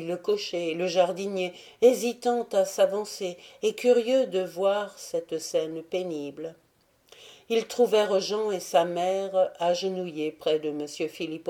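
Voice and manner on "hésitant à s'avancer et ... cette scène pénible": plain reading voice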